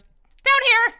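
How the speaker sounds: high, loud call down the stairs. Acted. Crone calls the party down the staircase, loud and high. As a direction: sing out loud and high to lead them.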